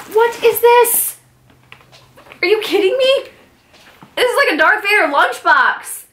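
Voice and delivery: can't believe the gift; squealing surprise; thrilled surprise. Real, not acted.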